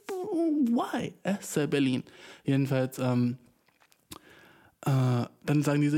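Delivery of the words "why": very high drawn-out mock protest; gespielte Empörung. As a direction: wail it in comic disbelief. Mock outrage at coffee price. (übertrieben hohes, langes Warum)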